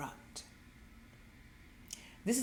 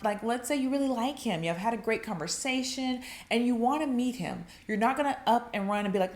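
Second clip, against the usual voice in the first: normal talking voice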